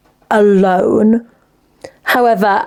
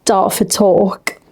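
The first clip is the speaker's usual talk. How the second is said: slow, drawn-out Dartford talk; a touch of Dartford twang; Dartford accent; much slower than usual. Retold or imitated. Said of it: "slip into, like, Dartford talk".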